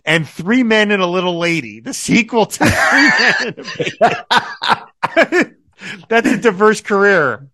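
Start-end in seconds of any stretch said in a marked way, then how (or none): 1.8-5.6 higher, slow, laughing through the words; helpless giggle overtaking the line; cracking up. Real, not acted. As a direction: break into real laughter as you finish. Cracks up at absurd credit.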